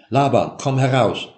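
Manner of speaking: loud call, Laban stressed, falling; calling through door. A narrator's reading. Jakob at Laban's chamber door, calling him out loudly. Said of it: "pochte und rief".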